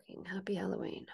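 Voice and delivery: sarcastic whisper. Real, not acted. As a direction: grumble it under your breath. Sour aside after sign-off.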